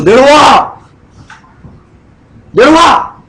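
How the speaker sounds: high mocking yell of a crowd; jeering shout. The crowd jeering at Jesus on the cross, high mocking shout.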